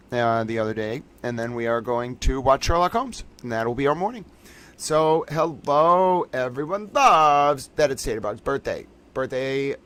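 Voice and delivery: ordinary delivery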